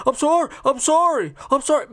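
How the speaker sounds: high-pitched panicked pleading, imitating the kid; mock panic. Kid pleading on his own boat, mock panic. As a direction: imitate a kid frantically apologizing. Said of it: "he's panicking".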